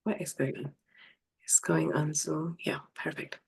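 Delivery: puzzled whisper to herself; hushed, half to herself; whispered confusion. Real, not acted. She wonders why the stream went to Zoom, whispering.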